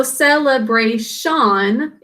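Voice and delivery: "celebration" with full vowels, SEL-EE-BRAY-SHAN; think-to-spell pronunciation. Shown as a demonstration. Teacher showing students how to think to spell, "celebration" as sel-ee-bray-shan. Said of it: "the way we're going to think to spell".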